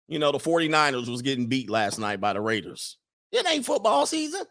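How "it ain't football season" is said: high-pitched, puzzled mama voice; suspicious mama. Acted. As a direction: question it in a high mock-mother voice. Acting out a suspicious parent.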